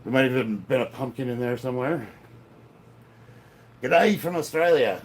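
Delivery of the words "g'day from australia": a hint of an Australian accent, not really pulled off; half-hearted Australian "G'day", slightly louder; bad Australian accent. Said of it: "I can't do that accent".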